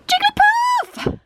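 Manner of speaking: very high, stretched-out squeal; excited squeal. Real, not acted. Collector pulls a beloved Jigglypuff card, excited squeal. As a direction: squeal the name in delight.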